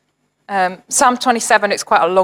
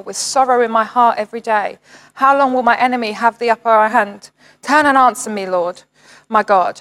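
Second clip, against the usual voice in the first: pleading psalm reading; 3 semitones higher than usual. Acted. Pastor voicing David's lament to God, earnest and pleading. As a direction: cry out to God through the verses.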